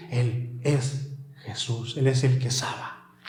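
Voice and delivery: slow, soft, low declaration; hushed reverence. Acted. Preacher telling the church, softly and slowly. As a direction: let it fall softly.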